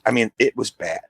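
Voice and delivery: ordinary talk, nothing marked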